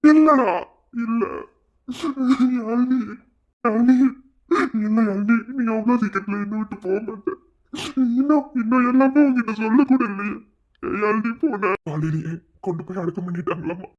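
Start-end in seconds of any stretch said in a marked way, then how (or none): 0.1-11.7 high, loud crying denial; boy wails over losing Yazhini; anguished wailing. Acted. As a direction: sob out the denial in despair. Grief climax.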